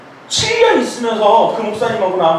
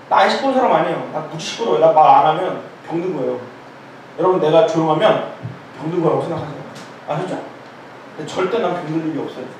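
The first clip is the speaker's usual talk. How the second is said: ordinary talk, nothing marked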